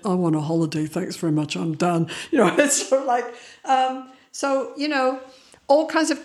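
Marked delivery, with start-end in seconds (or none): 0.0-2.1 low, deadpan opting out; worn-out refusal. Retold or imitated. Sleep-deprived nervous system quitting, weary refusal. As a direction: lightly voice a weary sign-off. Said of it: "your nervous system has already said".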